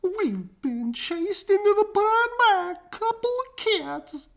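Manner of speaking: high, whiny moan; embarrassed dogs moaning. A narrator's reading. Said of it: "they moaned".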